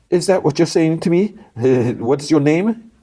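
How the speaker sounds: high, incredulous villain voice; taunting gang boss. Retold or imitated. Gang boss questions the lawyer's request, high and taunting. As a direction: question him in a put-on high voice.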